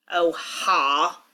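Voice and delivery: scowling, unimpressed retort; a grudging, sour comeback. A narrator's reading. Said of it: "Brenna says with a scowl".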